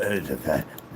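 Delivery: low guttural Tasmanian devil noises; sore-throat growl. Retold or imitated. Mocking the Batman actor's voice, throaty growl. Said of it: "Sound like Tasmanian devil with freaking sore throat".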